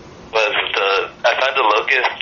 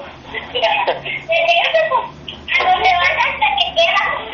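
high laugh. Real, not acted. Laugh in aside.

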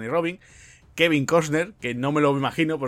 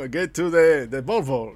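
put-on louder, lightly Austrian-accented Arnold line; a hint of Arnold's Austrian accent as a joke; mock Austrian accent, mild; 6 dB louder, much slower than usual. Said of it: "con su buen acento austriaco".

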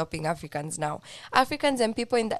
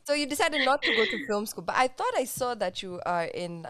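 normal talking voice

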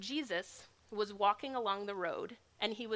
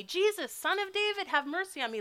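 much higher, louder desperate cry; yelling louder; 9 semitones higher, 5 dB louder than usual. Acted. Blind beggar shouts louder toward Jesus, desperate cry. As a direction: cry out louder still, over the crowd. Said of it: "louder and louder".

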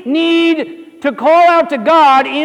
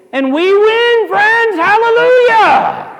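higher, slow triumphant hallelujah; rousing Billy Graham; 9 semitones higher, 4 dB louder, slower than usual. Retold or imitated. Preacher quoting Billy Graham, triumphant and higher. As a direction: proclaim the victory, building. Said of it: "As Billy Graham often said".

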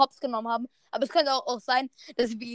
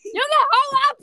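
shouting away; 11 semitones higher, slower than usual. Real, not acted. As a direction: bark him out the door. Shooing someone out.

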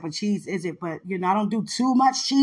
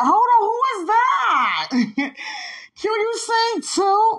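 excited shout; 5 dB louder, slower than usual. Retold or imitated. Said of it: "He was like".

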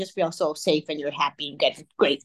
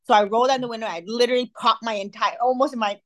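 ordinary delivery

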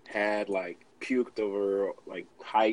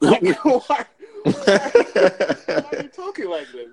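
laughing; 13 semitones higher, 11 dB louder, much slower than usual. Retold or imitated. Mocking the odd voice, laughing.

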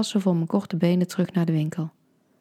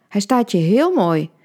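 louder, bright sales pitch leaning on heel; loud eager shop assistant; pushy saleswoman; 3 semitones higher, 7 dB louder than usual. A narrator's reading. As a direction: saleswoman barging in with a compliment. Saleswoman butts in loudly.

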